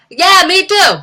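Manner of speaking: high, loud blurted agreement. Retold or imitated. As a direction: cut in as a hammed-up pop star. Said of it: "she's like".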